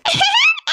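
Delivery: a bashful, high-pitched giggle; shy high giggle. Acted. Brother giggles shyly before telling his family about his girlfriend. Embarrassed about the new relationship.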